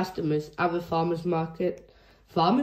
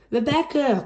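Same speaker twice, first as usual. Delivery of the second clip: calling out; 6 semitones higher, 3 dB louder than usual. Acted. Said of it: "cried Pepper".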